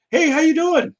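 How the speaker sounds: high, friendly woman's hello; high-pitched imitation of a passing woman; girl's greeting. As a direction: call out sweetly as the girl. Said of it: "a girl was like".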